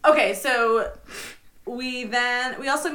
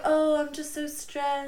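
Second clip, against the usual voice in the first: languid diva; 7 semitones higher, slower than usual. Retold or imitated. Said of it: "And she's just like".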